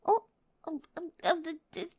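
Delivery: stumbling nervous denial; flustered stammer. Acted. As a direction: caught out, stammering high. Caught with her journal.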